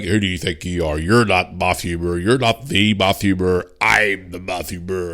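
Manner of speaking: slow, hammed-up wrestling promo boast; mock wrestling promo. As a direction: lightly ham it up like an early-nineties wrestler cutting a promo. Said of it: "sound like a fucking wrestling promo".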